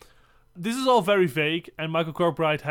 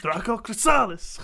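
higher-pitched mock incantation, stressed SAH; spell-casting; 5 semitones higher than usual. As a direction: cast it like a wizard's spell. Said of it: "like a Harry Potter spell".

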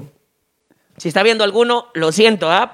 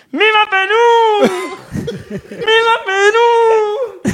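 high, very slow nasal-speech yell; nasal-speech cheer; 4 dB louder, much slower than usual. Acted. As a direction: cheer it through the nose, stretching every word. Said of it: "Y entra él". (grito gangoso, muy lento y agudo)

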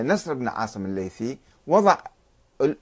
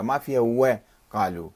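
ordinary delivery

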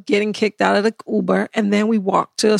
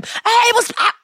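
mocking defensive client; 11 semitones higher than usual. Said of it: "And I'm like".

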